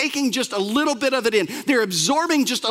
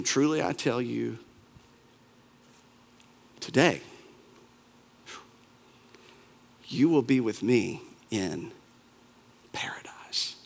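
softer and lower, sinking to a whisper on paradise; tender promise; 10 semitones lower, 7 dB quieter than usual. Retold or imitated. Jesus promises the thief paradise, softly fading to a whisper. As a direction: let it fade down to a hush.

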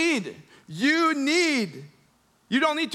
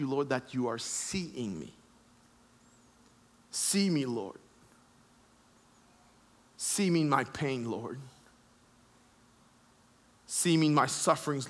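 hushed prayer; 9 semitones lower, 4 dB quieter than usual. Acted. Pastor leading the congregation in prayer with eyes closed, hushed and low. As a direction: pray it quietly, as if eyes closed before the Lord.

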